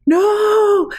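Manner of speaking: high, loud cry of alarm; scared scream. Real, not acted. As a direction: shriek no at the eyes. Player reacts to six eyes.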